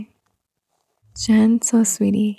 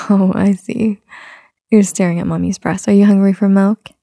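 ordinary talk

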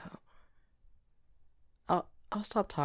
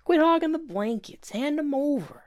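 higher, louder playful whine; mock whiny complaint; 12 semitones higher, 7 dB louder than usual. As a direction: whine for the blankets. Mock complaint over blankets.

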